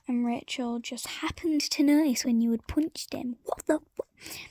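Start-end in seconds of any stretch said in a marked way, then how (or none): none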